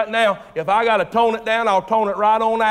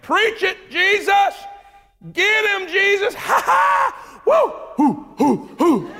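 cheering crowd; 11 semitones higher, slower than usual. Retold or imitated. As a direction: cheer and whoop like a rowdy crowd. Crowd cheering and whooping at Jesus.

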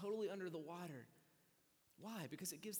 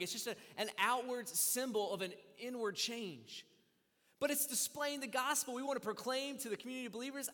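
raised, ringing pulpit delivery; preaching at full force; 7 semitones higher, 8 dB louder than usual. Acted. Pastor urging the congregation to get baptized, preaching at full force. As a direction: proclaim it to the whole room.

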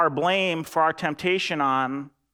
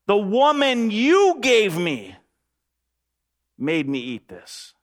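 slow, higher, louder protest; Adam pointing the finger, slow and raised; Adam blaming God; 5 semitones higher, 4 dB louder, slower than usual. Acted. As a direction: drag it out like a defensive excuse. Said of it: "He says to God".

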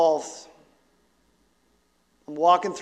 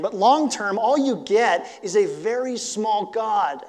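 ordinary talk

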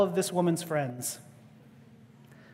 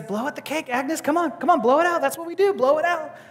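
higher, eager coaxing to blow the candles; coaxing urge; 7 semitones higher, faster than usual. Retold or imitated. Harry urging Agnes to blow out the candles, eager coaxing. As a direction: egg her on, cheerful and pushing.

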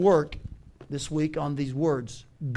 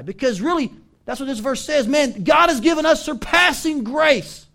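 loud, raised preaching voice; emphatic preaching; 7 semitones higher, 8 dB louder than usual. Real, not acted. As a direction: drive the point home to the congregation. Preacher proclaims the verse.

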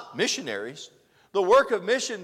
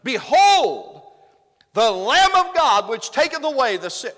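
high, very loud preacher's cry; emphatic proclamation; 7 semitones higher, 11 dB louder than usual. Acted. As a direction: proclaim it like a herald to a crowd. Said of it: "No, he didn't say it like that".

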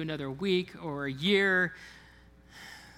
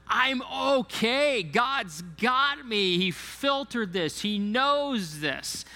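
slow, higher, louder trusting declaration; calm inner assurance; 6 semitones higher, 5 dB louder, slower than usual. Pretend. Voicing the believer's trusting inner voice.

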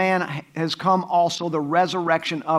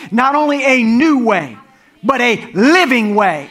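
normal talking voice

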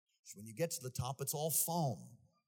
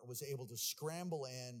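usual speaking voice

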